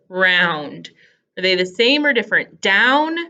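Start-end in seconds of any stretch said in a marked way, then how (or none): 0.1-0.9 GROUND split as g, pause, round; lower, segmented g...round; sounded-out G. Shown as a demonstration. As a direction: isolate the g of ground. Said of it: "very first sound".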